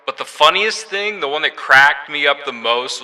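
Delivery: loud megaphone effect. Shown as a demonstration. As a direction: talk through a megaphone. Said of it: "this is the megaphone".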